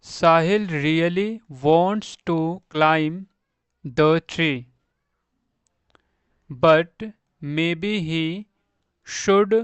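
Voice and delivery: sentence read word by word, very slowly; slow reading aloud. Shown as a demonstration. Teacher reading an example about Sahil to pupils, slow. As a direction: read slowly with long pauses for learners.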